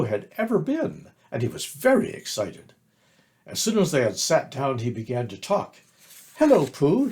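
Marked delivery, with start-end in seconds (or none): 6.4-7.1 high, louder squeak; squeaky. A narrator's reading. Excited little Roo greeting Pooh, high squeak. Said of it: "he squeaked".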